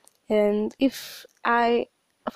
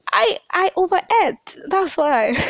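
much higher, louder flustered excuse; defensive protest; 9 semitones higher, 5 dB louder than usual. Retold or imitated. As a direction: blurt the excuse, caught out. Protesting to mom.

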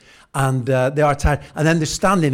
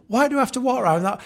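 exasperated self-talk; 10 semitones higher, faster than usual. Retold or imitated. Exasperated at own furniture.

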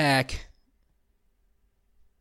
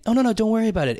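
higher, quick-flowing mock-polite brush-off; imitated polite refusal; 6 semitones higher, much faster than usual. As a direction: wave off the help lightly, pitched up. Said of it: "But not like in a".

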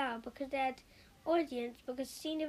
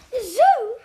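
much higher, far louder cry of dismay; shocked wife hearing her crocodile goes to the zoo; miserable exclamation; 11 semitones higher, 19 dB louder than usual. A narrator's reading. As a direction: cry out in horror at the news. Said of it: "Missus Prim exclaimed miserably".

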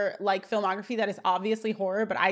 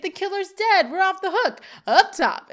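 high, loud celebratory exclaiming; imitating a goofy celebration; mock jubilant relief; 10 semitones higher, 5 dB louder than usual. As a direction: crow with relief, pitched right up. Retold Eric celebrating.

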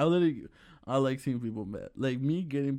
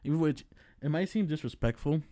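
normal talking voice

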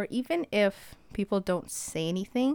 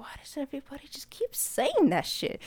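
hissed under the breath, annoyed; exasperated whisper; 6 semitones higher, 4 dB quieter than usual. Retold or imitated. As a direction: mutter it so she can't hear. Annoyed retort to accusing girl.

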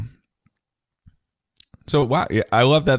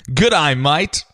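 Australian accent again, rising g'day; a second Australian accent try; 4 semitones higher than usual. Shown as a demonstration. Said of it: "You wanna hear it?".

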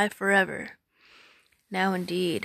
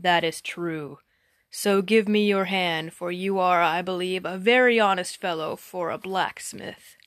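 sly giant offering his hand; 2 semitones lower than usual. Acted.

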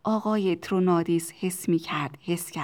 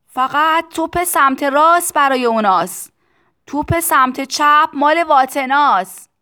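loud, raised-pitch yell; shouting; 7 semitones higher, 12 dB louder than usual. A narrator's reading. Factory owner telling Tistu who gets which cannon, shouting. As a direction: shout the explanation over the din. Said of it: "آقای ترونادیس با فریاد گفت".

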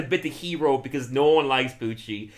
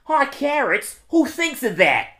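much higher, louder cartoon voice; Wegman impression; 12 semitones higher, 6 dB louder, slower than usual. Quiz for the cohost, high squeaky impression. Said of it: "I'm doing Mike Wegman".

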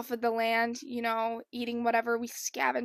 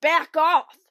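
annoyed shout, higher and louder; angry shout; 4 semitones higher, 10 dB louder than usual. Retold or imitated. John B yelling at the copycat to back off. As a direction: bark it at the copycat. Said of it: "He's like".

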